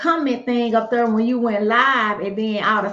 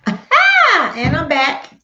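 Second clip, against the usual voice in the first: high, loud triumphant cheer; excited; 6 semitones higher, 9 dB louder, slower than usual. Real, not acted. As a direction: burst out happily, sound is back. Sound returns after tech trouble.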